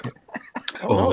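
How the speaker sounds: breathy slow whisper. Real, not acted. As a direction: whisper it, embarrassed. Whispered unvoiced answer.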